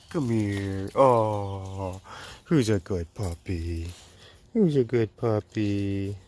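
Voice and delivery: slow cooing puppy talk; slow, tender pet voice; talking to a dog. Real, not acted.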